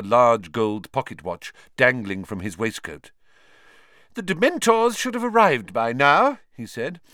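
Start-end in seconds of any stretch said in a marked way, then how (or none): 4.1-6.4 pompous minister. Acted. Fudge pompous.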